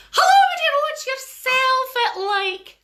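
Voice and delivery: high chirpy Scottish neighbour; chirpy old neighbour. Acted. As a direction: greet her like a high-voiced cheery old neighbour. Neighbour greeting.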